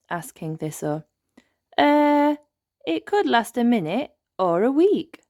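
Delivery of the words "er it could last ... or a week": higher, louder flustered hesitation; nervous reply. Acted. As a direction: stammer it out caught in a mistake. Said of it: "replies Nanny Plum nervously".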